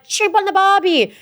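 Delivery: high, slow mock Aussie catchphrase; hammed-up Australian accent. Mocking what producers wanted said, hammed-up Australian accent. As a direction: exaggerate the Australian twang, pitched high. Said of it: "they wanted us to be like".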